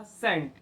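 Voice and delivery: सेंट starting with an S sound; soft C. Shown as a demonstration. Teacher modelling C before E, सेंट with an S sound. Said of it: "तो इसका pronunciation क्या होगा?".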